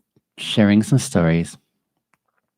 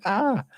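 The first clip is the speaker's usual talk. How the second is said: high emotional "ah"; 13 semitones higher than usual. Retold or imitated. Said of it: "I'm just like".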